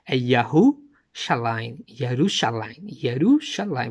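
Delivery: slow, drawn-out Yerushalayim, each syllable spelled out; careful Hebrew pronunciation. Shown as a demonstration. Preacher teaching his audience the Hebrew name, slow and deliberate. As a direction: say Yahushalayim slowly like a teacher. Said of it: "Vou repetir".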